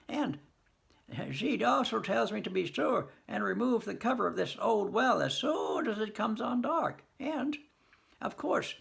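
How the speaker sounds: put-on high, bewildered old farmer's voice; a simple old man thinking aloud, baffled; puzzled old farmer. Old Harley telling Nicely Nicely about the well, high and puzzled.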